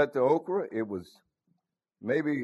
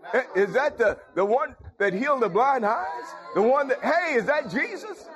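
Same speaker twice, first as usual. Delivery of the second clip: high, breathless-with-wonder questioning; excited blind man; 8 semitones higher than usual. Acted. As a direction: call to others eagerly, voice riding high. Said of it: "Blind man got excited".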